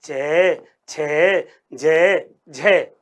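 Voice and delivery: low, separate चे छे जे झे; teacher sounds out चे छे जे झे low; affricate demo. Shown as a demonstration. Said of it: "कौन कौन से?".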